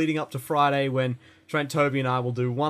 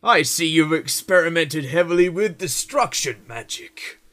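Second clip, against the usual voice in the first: Oblivion guard voice; 3 semitones higher, 5 dB louder than usual. Retold or imitated. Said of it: "where people would be like".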